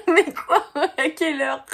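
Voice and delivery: high, loud astonishment; astonished. Real, not acted. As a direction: react in disbelief. Astonished at note.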